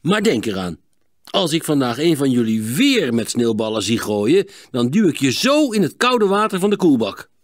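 loud warning, rising in pitch; stern warning. Acted. Father warns boys about snowballs, stern warning. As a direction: warn them with mounting force. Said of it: "waarschuwde Klinkhamer".

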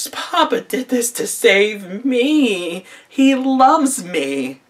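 high, very slow, drawn-out gushing; mock adoring daughter. Daughter realising Papa saved her, mock adoring gush. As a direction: imitate a lovestruck-sounding daughter, stretching words melodramatically. Mimics daughter swooning over father's rescue.

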